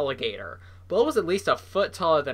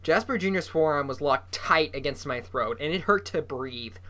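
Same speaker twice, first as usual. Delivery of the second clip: ordinary delivery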